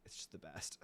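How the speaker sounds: whisper. Real, not acted. Whispered aside.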